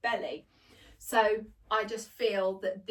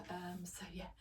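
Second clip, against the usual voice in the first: whispering, catching her breath; panting whisper after rushing downstairs; breathless whisper; 3 semitones lower, 13 dB quieter than usual. Real, not acted. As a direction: hush while trying to breathe.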